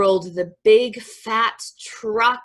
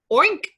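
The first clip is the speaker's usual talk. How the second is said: quick high oink; short, squealed pig noise; 9 semitones higher, 5 dB louder than usual. A narrator's reading. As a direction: oink like a little piggy. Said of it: "said a piggy".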